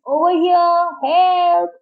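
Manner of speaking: high, sustained calls for help; yelling for help. A narrator's reading. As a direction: call out to a distant boat. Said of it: "waving his arm and yelling".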